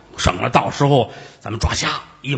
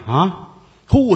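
ordinary talk, nothing marked

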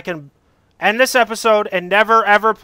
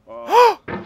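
sharp gasp; 9 semitones higher than usual. Real, not acted. Sudden fall in game.

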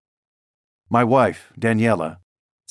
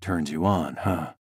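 softer, lower and intimate; quieter; 4 semitones lower, 5 dB quieter than usual. Acted. Husband to wife over wine, softer. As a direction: drop the voice close to her.

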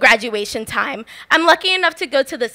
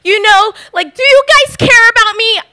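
pleading to city council, higher and louder; a kid's heartfelt plea, raised voice; impassioned plea; 8 semitones higher, 8 dB louder than usual. Retold or imitated. Kid pleading with the city council, higher and louder. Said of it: "I do this impassioned speak".

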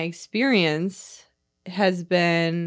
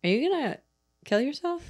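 worried ex-husband; 9 semitones higher, faster than usual. Retold or imitated. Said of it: "And he's like".

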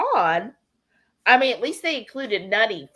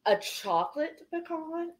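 surprised; 7 semitones higher, 8 dB quieter than usual. Real, not acted. Reacting with surprise to a flavor.

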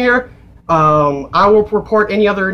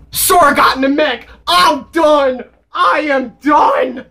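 overwhelmed, higher-pitched exclaiming; loud excitement; 5 semitones higher, slower than usual. Real, not acted. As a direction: blurt it out, overexcited. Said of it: "I'm sorry if I'm being loud".

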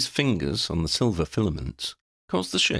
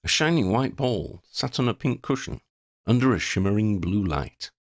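usual speaking voice

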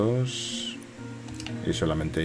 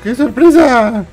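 high, slow, sarcastic echo; mocking echo; 7 dB louder, much slower than usual. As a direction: parrot the villain's line back slowly. Echoing the game villain mockingly.

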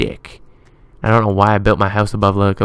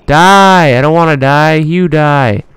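loud, raised-pitch battle shout; hollering in the heat of a game fight; combat yell; 6 semitones higher, 11 dB louder, slower than usual. Real, not acted. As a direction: shout it at the attacking monster.

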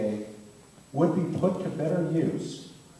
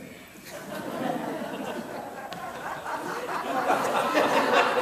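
breathy laugh; 12 semitones higher than usual. Real, not acted. Moderator laughs at own reading.

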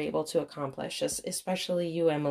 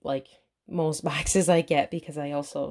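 ordinary delivery

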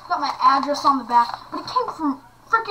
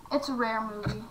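ordinary delivery

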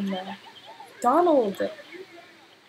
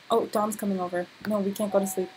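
normal talking voice

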